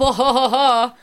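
loud high laugh; burst of laughter. Real, not acted. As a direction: laugh out at her own joke. Laughs at own mockery.

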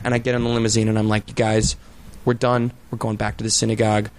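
cool and quiet, no weakness shown; controlled calm. Retold or imitated. Chaperone telling kids they're done, softly. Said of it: "I didn't let them know I was pissed".

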